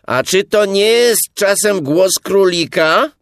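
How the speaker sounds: high, loud shouted question; loud, high call. A narrator's reading. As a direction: holler a suspicious question into the hole. Pooh calls into the burrow.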